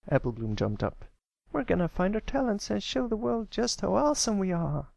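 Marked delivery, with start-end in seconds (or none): 1.5-4.9 very high eager filly voice. Acted. Applebloom hyping up the Crusaders, very high and eager. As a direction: hype up the team.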